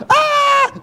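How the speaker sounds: high, loud imitated shriek of joy; mock joyful shriek, high and loud; joyful scream. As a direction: shriek with delight.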